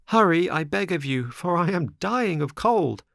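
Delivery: high, begging puppet voice; pleading boy. A narrator's reading. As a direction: beg like a freezing boy. Pinocchio begs.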